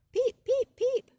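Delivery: high, loud piping peeps; high frog peep. Acted. The spring peeper calling in the woods, high and loud. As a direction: chirp it like a tiny frog.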